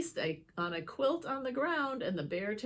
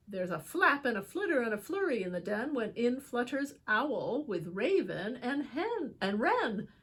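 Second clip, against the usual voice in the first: usual speaking voice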